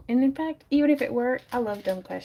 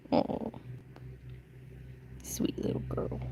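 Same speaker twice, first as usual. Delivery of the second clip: whisper; 10 dB quieter than usual. Real, not acted. Whispering to the dog.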